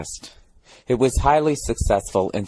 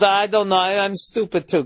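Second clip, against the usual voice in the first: mock Danish cabbie; 8 semitones higher than usual. Cab driver's reply mimicked.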